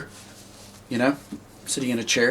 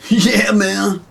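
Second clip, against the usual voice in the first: high party-dude turtle voice; Michelangelo; 8 semitones higher, 3 dB louder than usual. Shown as a demonstration. Puppeteer modelling his alien on a cartoon turtle, high bro voice. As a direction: pitch it up with totally-bro attitude. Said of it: "Michelangelo from Teenage Mutant Ninja Turtles attitude".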